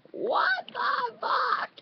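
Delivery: very high, loud, slow scream; screamo scream. Shown as a demonstration. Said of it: "I'll try to show you the best I can do".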